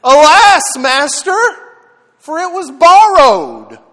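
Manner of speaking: anguished high cry of loss; cried out. Acted. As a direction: wail it out, desperate, high and loud. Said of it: "he cried out and said".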